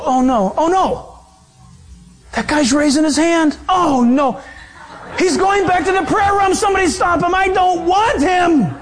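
preacher mocking a God who dreads a convert; mock panic. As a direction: play a frantic, reluctant God for the joke. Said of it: "He doesn't go".

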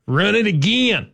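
high, slow mock coach holler; O-line coach impression. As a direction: drag it out like a Southern line coach. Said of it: "impersonate offensive line coaches".

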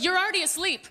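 ordinary talk, nothing marked

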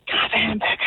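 whispered joke punchline. Acted. Customer asking the librarian after being told off, whispered. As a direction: whisper it as if obeying library rules.

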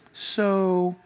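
ordinary talk, nothing marked

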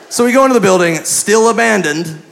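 ordinary talk, nothing marked

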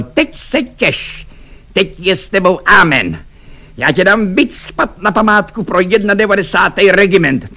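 high-pitched officer's threat; threatening exclamation. A narrator's reading. Lieutenant threatens Švejk face to face, high angry exclamation. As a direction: threaten him right into his face, high. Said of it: "přímo do obličeje zvolal".

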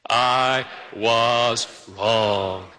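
very slow, drawn-out words; slow dry run. Shown as a demonstration. Pastor leading the congregation in a practice apology, slowly. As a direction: lead a group through it word by word. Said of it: "Let's just say it out loud together".

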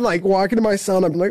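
ordinary talk

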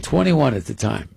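ordinary talk

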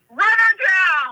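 loud, high, drawn-out yell; hammed-up shout, stretched out; yelled order. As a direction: holler a reckless command. Cousin orders her, retold.

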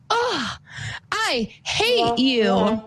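put-on high, slow little-boy voice, far above her own; child's voice. Mom quoting her four-year-old son at bedtime, high child voice. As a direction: voice the little boy's sulky line.

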